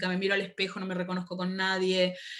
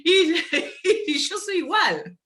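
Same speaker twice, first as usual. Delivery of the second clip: laughing; 6 semitones higher than usual. Real, not acted. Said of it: "me sale igual".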